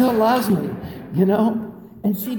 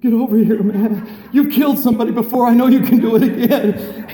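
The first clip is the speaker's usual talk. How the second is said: mock loud plea; 3 semitones higher, 7 dB louder than usual. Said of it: "I'm like".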